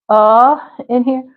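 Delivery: ordinary delivery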